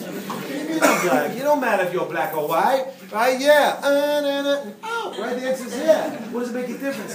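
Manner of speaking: put-on high, loud, laughing little kid; breathless, giggly child voice; excited toddler voice. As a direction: squeal it like a baffled two-year-old. Voicing the confused toddler.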